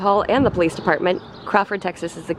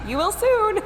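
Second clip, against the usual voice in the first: excited; 12 semitones higher, 3 dB louder, slower than usual. Real, not acted. Joking about a passerby.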